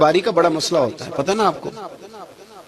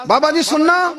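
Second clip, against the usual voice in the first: put-on child calling out; 10 semitones higher than usual. Child calls out again, higher.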